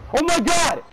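very high, very loud scream of terror; terrified scream. Real, not acted. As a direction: scream at the top of your lungs. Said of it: "I just screamed harder".

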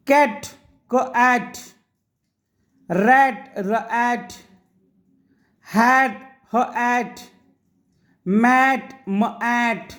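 each word, then split into its first consonant and the sound ॲट; teacher breaking words into sounds; sound-by-sound reading. Shown as a demonstration. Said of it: "असं मी का वाचतो आहे".

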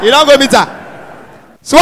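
ordinary talk

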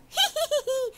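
high teasing little laugh; cheeky high giggle. A narrator's reading. Gingerbread cowboy laughing at the rancher, high giggle. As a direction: giggle up high like a naughty cookie. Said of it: "se rió y dijo".

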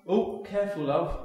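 high alarmed warning. Acted. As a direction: yelp a quick warning. Warning the snail.

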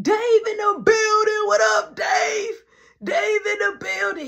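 high, loud hype call; hype greeting. Retold or imitated. Greeting a late coworker loudly in the kitchen. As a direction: announce him like a star arriving.

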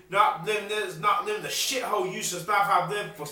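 ordinary talk, nothing marked

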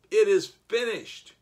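shouted loud and high, a triumphant cry. Retold or imitated. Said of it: "He cried out loud".

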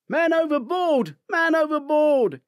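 high, loud alarm shout; man overboard shout. Acted. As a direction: yell in alarm for help. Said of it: "is the shout on deck nine below".

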